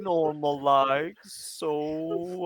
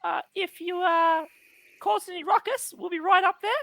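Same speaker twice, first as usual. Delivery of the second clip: fast, higher Australian bogan voice; sassy bogan; 11 semitones higher, faster than usual. Acted. Tree dropping its act in a fast Australian bogan voice. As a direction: rattle it off like a cheeky Aussie bloke. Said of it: "Why does he sound like a sassy bogan?".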